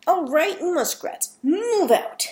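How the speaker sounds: high, loud rallying call; acted shout to the scouts; shouted command. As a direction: call the troop out, pitched high. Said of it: "Zoe shouted and led the way".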